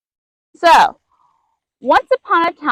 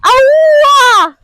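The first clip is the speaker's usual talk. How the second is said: high, loud animal wail of alarm; 13 semitones higher, 8 dB louder than usual. Acted. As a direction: wail out like a lemur sounding the alarm. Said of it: "wailed his alarm".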